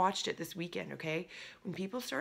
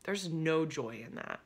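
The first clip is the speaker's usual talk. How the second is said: ordinary talk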